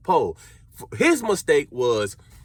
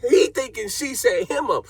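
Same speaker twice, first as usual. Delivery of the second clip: ordinary delivery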